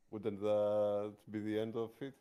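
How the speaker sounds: ordinary talk, nothing marked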